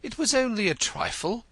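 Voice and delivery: stammering. A narrator's reading. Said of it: "stammered Denzil".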